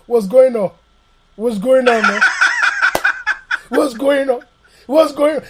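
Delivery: loud, badly faked American greeting; failed American accent. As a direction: attempt American, keep the Nigerian accent. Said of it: "in an American accent is".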